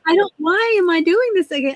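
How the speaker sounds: high-pitched mock complaint; exasperated protest. Patient replaying her objection to redoing check-in, exasperated. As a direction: pitch jumps up in incredulous frustration.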